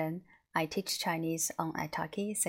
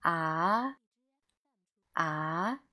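"Ah" sweeping up like a question; questioning "Ah?" with a smooth rise; rising second tone. Shown as a demonstration. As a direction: start "Ah" at mid pitch and glide upward. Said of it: "like a question tone".

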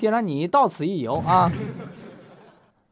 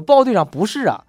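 loud report; 7 dB louder than usual. Acted. Fourth soldier answers the captain.

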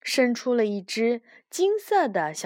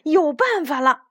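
higher, excited call; crying out; 5 semitones higher, 4 dB louder than usual. A narrator's reading. Banban has an idea mid-chase, crying out. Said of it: "斑斑大叫了一声".

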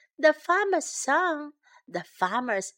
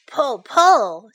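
loud heave-ho call; urging shout; 10 dB louder than usual. A narrator's reading. Son urging the team to pull, shouting. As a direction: shout it like a work chant.

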